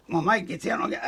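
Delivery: ordinary delivery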